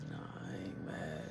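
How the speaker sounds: whispered denial; hushed whisper, hiding something. Retold or imitated. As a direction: breathe it so nobody hears. Hushed exchange in basement.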